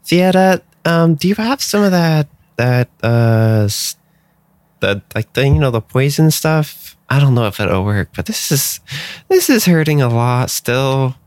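high, hurting little character voice; hurt creature character. Acted. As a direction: ask for help through acted pain. Plays the hurt Milea.

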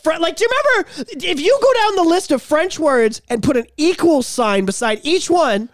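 incredulous, half laughing, pitch way up; stunned disbelief at the idea; exasperated laughing rant. Real, not acted. Exasperated rant rising loud.

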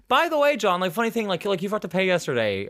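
high, breezy casual mention. Retold or imitated. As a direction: make it sound like no big deal. Casually mentions the unpaid coffee.

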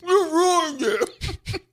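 laughing through the words; high, drawn-out laughing. Real, not acted. Laughing after tasting the chip.

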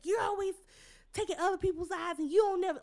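high, scolding mom voice. Retold or imitated. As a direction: mimic mom's accusing scold.

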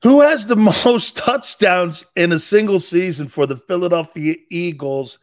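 loud question to a voice assistant; calling out. Real, not acted. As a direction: project the query so the device hears.

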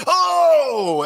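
loud, very high "oh!"; pained reaction yell. Retold or imitated. Host re-enacting his reaction to the phone clip, high yell. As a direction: cry out as if watching a hit land. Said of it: "you would have heard me going".

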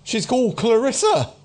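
high-pitched street prophet naming a devil; agitated doomsayer. Acted. Doomsayer asked for his devil's name, agitated. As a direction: confide it in a high, strained voice.

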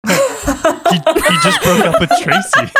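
high, loud, helpless laughter through the words; laughing hard. Real, not acted. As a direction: barely get the punchline out for laughing. Cracks up at own punchline.

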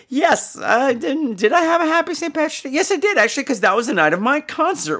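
high chirpy voice. Acted. Host squeaky upbeat voice.

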